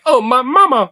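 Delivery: put-on lightly high mama's boy whine; a hint of a clingy little boy; mocking mama's boy. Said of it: "is supposed to be like".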